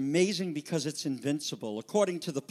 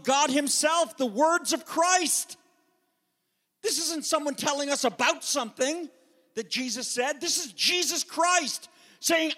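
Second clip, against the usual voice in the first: louder, higher, building sermon delivery; fervent preaching; 7 semitones higher, 3 dB louder than usual. Acted. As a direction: preach it out with rising fervor. Preaching at full force.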